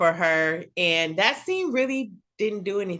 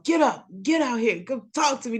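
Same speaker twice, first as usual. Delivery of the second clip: acting tough; 3 semitones higher than usual. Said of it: "That's how he was talking".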